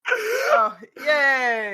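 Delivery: high, loud little yay; cheering. Real, not acted. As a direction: cheer the lucky roll. Player cheers a good reroll.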